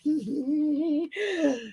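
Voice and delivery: high, soft gleeful titter; mischievous giggle. Retold or imitated. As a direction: giggle like you relish embarrassing them. Acts her gleeful reaction.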